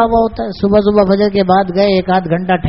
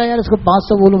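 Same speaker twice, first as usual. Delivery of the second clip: ordinary delivery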